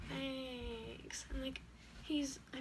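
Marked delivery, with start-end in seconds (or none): 0.1-1.2 small, quiet retold reply; muted, awkward thanks; unenthusiastic thanks. Her retold reply to the hug.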